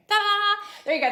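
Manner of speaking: high, loud, sing-out ta-da; playful ta-da. Pretend. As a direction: present it like a little magic trick. Mock reveal of the summary.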